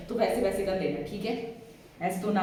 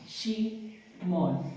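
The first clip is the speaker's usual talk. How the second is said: Simeon stretched out very slowly; slow name demo; much slower than usual. Shown as a demonstration. Teacher drills the class on the name, slow. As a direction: draw out Simeon as a memory hook. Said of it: "वो चुपचाप है".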